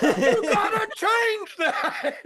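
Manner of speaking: very high, slow, laughing creepy voice. Pretend. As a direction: stretch each word very high and eerie. Mock menacing demand repeated.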